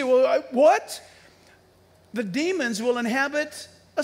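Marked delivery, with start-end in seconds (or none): 0.0-1.0 loud, high, puzzled "well, I, what?"; mock baffled what. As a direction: play the stunned listener, caught off guard. Listener objecting, mock confusion.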